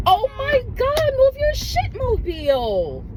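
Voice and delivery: high, exasperated yell at traffic; road rage outburst. Real, not acted. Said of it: "road rage took over".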